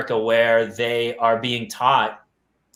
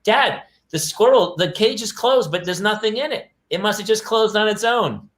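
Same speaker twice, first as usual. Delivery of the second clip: daughter voice; 9 semitones higher than usual. Retold or imitated. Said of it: "she goes".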